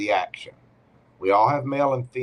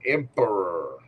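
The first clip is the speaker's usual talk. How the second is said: usual speaking voice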